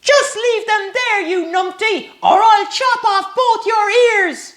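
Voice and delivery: shouted threat. Acted. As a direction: shout over a cheering crowd. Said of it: "shouted through the cheers".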